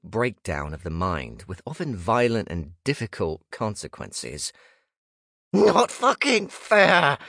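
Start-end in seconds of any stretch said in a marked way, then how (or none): 5.5-7.2 high, slow, furious scream; ghost scream. Acted. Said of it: "the rogue ghost screamed".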